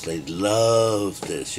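drawn out very slowly; slow savoring. Real, not acted. Mocking the people who love it, slow and savoring. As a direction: stretch every word.

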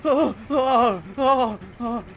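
hammed-up wailing lover; overacted heartbroken cries; melodramatic grief. Killer mourns hooked survivor, melodramatic.

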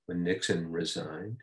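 normal talking voice

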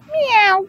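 very high, squeaky meow; a high kitty meow for the class; cat meow. Shown as a demonstration. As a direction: meow like a little kitten. Animal sounds while reading aloud.

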